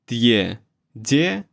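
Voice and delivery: normal talking voice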